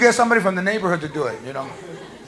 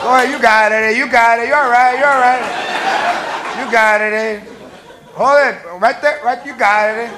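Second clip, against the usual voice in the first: Chicano spotter; 2 semitones higher than usual. Acted. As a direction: cheer him on like a spotter, eh. Worker egging on customer lifting.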